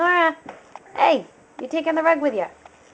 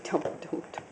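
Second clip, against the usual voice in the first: put-on whispered baby voice; 12 dB quieter, much faster than usual. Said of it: "She's like".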